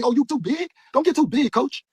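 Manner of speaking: high mocking voice of a clingy coach; a fighter mocks his coach in a high whiny voice. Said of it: "Then they treat us like they".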